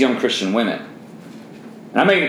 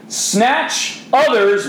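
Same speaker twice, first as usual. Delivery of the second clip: dramatic raised voice; 10 semitones higher, much slower than usual. Shown as a demonstration. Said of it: "I did raise my voice a little bit for the drama of it".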